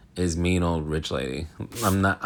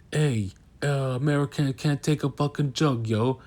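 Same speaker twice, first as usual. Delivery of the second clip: higher-pitched, badly faked Italian accent; 8 semitones higher than usual. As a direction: do a hammy, bad Italian-accent impression. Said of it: "That was a terrible Italian accent".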